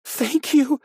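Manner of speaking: high, shaking sobbing thanks; high trembling thanks to the captor. A narrator's reading. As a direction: thank them while trembling. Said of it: "Joyce Ann shook, trembling".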